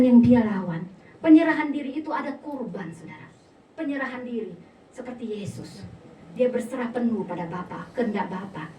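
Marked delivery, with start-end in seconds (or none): none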